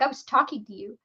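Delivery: normal talking voice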